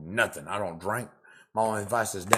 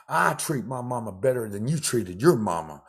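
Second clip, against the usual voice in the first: normal talking voice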